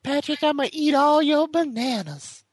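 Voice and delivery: high twangy diner waitress voice; hammed-up high waitress voice. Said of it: "somehow became, like, diner waitress".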